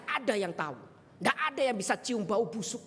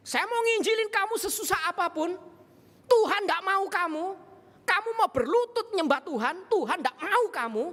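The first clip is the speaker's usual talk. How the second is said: higher, louder, hurling the words back; angry retort; 7 semitones higher, 4 dB louder than usual. Retold or imitated. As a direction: snap back at him hard, voice raised. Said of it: "orang gila berani balas marah".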